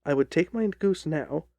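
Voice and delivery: plain reading voice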